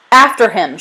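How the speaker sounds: loud rallying shout; shouted order. A narrator's reading. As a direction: shout to start the chase. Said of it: "shouted Thomas".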